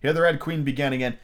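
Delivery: plain reading voice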